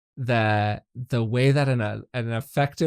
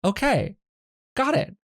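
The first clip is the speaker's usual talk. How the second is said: high, loud exaggerated reasonable tone; mock patient; 7 semitones higher, 4 dB louder than usual. Partner offering a plan, high and loud. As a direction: play the calm problem-solver. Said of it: "And then I'm like".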